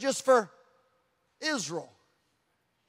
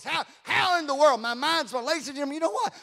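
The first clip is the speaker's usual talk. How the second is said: normal talking voice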